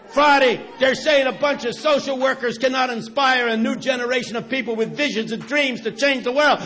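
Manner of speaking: shouted, high, fervent preacher's call; booming sermon shout; preaching at full force. Acted. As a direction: whip the crowd up like a revival preacher.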